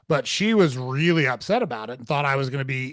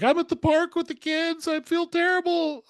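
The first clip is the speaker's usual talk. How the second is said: tearful wife. Retold or imitated. Wife calling from the park in tears, high mock-tearful voice. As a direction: mimic the wife crying over the bills. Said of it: "And she's in tears".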